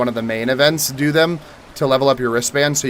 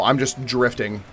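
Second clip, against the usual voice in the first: ordinary delivery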